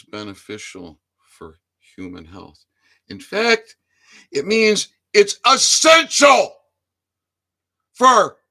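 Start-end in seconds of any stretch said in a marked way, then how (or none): 3.2-8.4 loud, higher, slow emphatic yell; furious shout. Real, not acted. Vlogger ranting about cannabis, loud emphatic shout. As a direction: bellow the word out.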